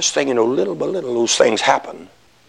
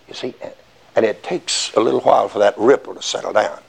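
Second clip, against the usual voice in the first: ordinary talk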